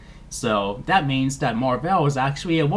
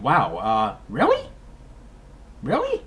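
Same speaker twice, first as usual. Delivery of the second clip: surprised. Retold or imitated. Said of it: "I'm like".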